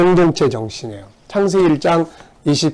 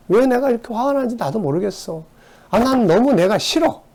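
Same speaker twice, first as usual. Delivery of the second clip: frustrated inner voice; 8 semitones higher than usual. Retold or imitated. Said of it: "막 이런 거예요".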